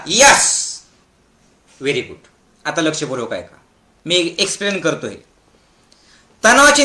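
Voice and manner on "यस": confident, emphatic YES; full confidence. Shown as a demonstration. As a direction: say it with complete conviction. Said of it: "एकदम विश्वासाने एकदा बोला". (पूर्ण विश्वासाने)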